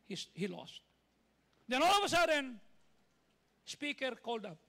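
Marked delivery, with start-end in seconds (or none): 1.7-2.6 loud high preacher's exhortation; preaching at full force. Acted. Pastor urging the congregation, loud fervent preaching. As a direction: preach it out to the crowd with fire. Preacher building story climax.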